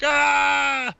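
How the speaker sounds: high, loud drawn-out "ah!"; excited greeting scream. Retold or imitated. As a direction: holler out in delight at seeing a friend. Retelling an excited greeting on arrival.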